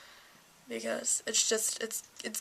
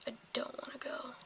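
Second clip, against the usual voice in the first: whispered; 10 dB quieter than usual. Real, not acted. A teen whispering to viewers about dreading driving school. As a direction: repeat "I don't wanna go" in a much softer unvoiced whisper.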